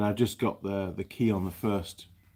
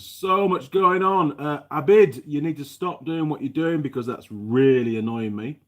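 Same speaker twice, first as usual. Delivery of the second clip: louder and higher, exasperated; exasperated outburst; 6 semitones higher, 7 dB louder than usual. Real, not acted. Telling a chatter Abid to stop, raised and annoyed. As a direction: snap at the distraction. Chat distracting him, tells Abid off.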